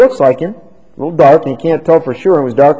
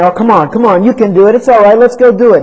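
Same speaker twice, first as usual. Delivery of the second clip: louder, higher coaxing; urging crowd; 7 semitones higher, 6 dB louder than usual. Acted. Sinful crowd coaxing a believer, loud cajoling. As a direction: egg them on like a tempting crowd. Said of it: "The crowd was saying".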